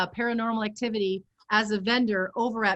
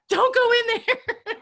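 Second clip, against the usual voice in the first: high, slow laughing mock warning; a playful shout at the horror heroine; yelling at the screen; 11 semitones higher, 4 dB louder, slower than usual. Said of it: "love to yell and scream".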